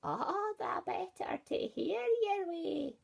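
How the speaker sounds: high, slow sly granny voice; the wolf drawing it out slyly; wolf answering the girl in high granny voice. Acted. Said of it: "said the wolf".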